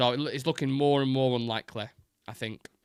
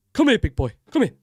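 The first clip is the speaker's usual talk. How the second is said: loud, pitched-up chef's command; Gordon Ramsay impression; 9 semitones higher, 10 dB louder than usual. Shown as a demonstration. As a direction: order them over like a shouty TV chef. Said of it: "transition humorously into Gordon Ramsay impression".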